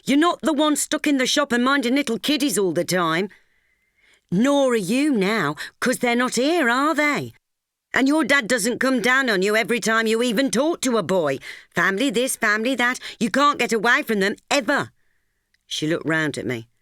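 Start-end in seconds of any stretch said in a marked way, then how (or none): 0.0-3.3 higher, louder, resentful complaint; a hot-tempered girl snapping back; short-tempered. Acted. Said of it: "Teresa's temper had a short fuse". 4.3-14.9 higher and louder, bitter; two friends bickering, rising frustration; heated argument. Acted. Girls quarreling about family.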